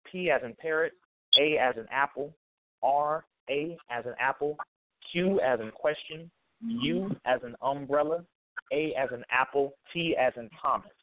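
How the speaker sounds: "P as in parrot" spelled letter by letter, each letter named with a word; spelling it out. Shown as a demonstration. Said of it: "Can, can you repeat them for me?".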